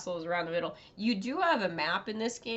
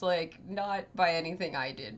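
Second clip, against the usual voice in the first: normal talking voice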